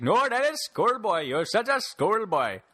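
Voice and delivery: high, slow Indian accent mimic; Indian accent impression. Mimicking his Indian friend refusing Spider-Man, in an Indian accent. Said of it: "he has that cliche accent".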